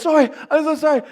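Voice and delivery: put-on quick, high childish apology; mock little girl. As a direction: rush the sorry like a scared kid. Voicing daughter apologizing.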